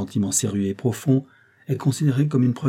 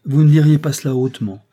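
loud, raised-pitch interjection; interrupting; 5 semitones higher, 8 dB louder than usual. A narrator's reading. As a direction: cut in firmly on the other speaker. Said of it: "interrompit André". (interruption vive, voix plus forte)

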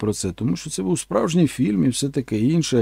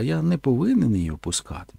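ordinary delivery

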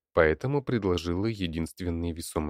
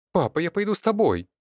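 put-on high, loud girl's cry; sudden shout; 12 semitones higher, 5 dB louder than usual. A narrator's reading. Daughter begs to follow her father, shouting. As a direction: shout it out much higher, urgently. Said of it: "вдруг закричала она".

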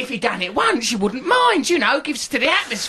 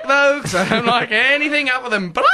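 ordinary delivery

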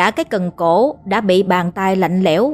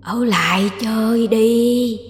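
slow ghost taunt; 2 semitones higher, slower than usual. Acted. Ghost repeats, slower and drawn out.

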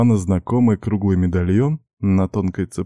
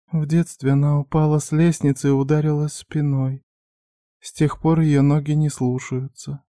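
put-on higher, timid young woman; young girl voice; 7 semitones higher than usual. A narrator's reading.

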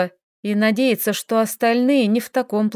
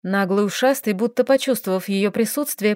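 ordinary delivery, nothing marked

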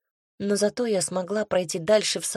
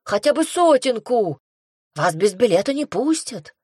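louder, higher shout; 5 semitones higher, 4 dB louder than usual. A narrator's reading. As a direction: yell after a runaway. Barker shouting after her.

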